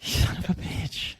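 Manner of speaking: slow whispered curse; muttered curse. Retold or imitated. Host retelling seeing the neighbor's new sod, whispering. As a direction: breathe the curse out in disbelief.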